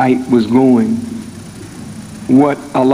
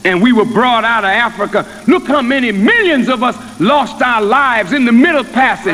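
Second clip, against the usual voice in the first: higher, ringing preacher's voice building to a peak; full-force preaching; 7 semitones higher than usual. Acted. Minister voicing God's plan to the congregation, rising preaching.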